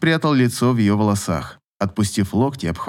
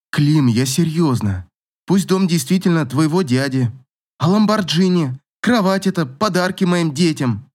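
higher-pitched, insistent woman's objection; serious, protesting Katya; 6 semitones higher than usual. Acted. Katya tells Klim she is serious about the gifts, insistent. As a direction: press him earnestly, voice climbing as the list goes on.

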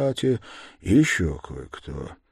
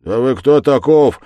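loud, raised-pitch challenge; menacing shout; 6 semitones higher, 12 dB louder than usual. A narrator's reading. Yard-keeper challenges a suspicious stranger, shouting menacingly. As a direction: demand it threateningly at full voice. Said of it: "крикнул он погрознее".

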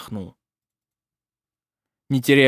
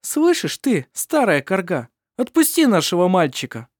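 angry; 6 semitones higher, 4 dB louder than usual. Acted. Sol confronts the evil spirit.

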